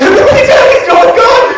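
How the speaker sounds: high panicked shout. Retold or imitated. Bouncer panics at fake gun.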